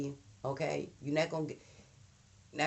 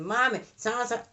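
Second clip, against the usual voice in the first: lightly voiced child butting in, higher and louder; a kid barging into grown folks' talk; interrupting child; 8 semitones higher, 5 dB louder than usual. Child cutting into the adults' conversation, mock eager. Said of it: "You come and interrupting".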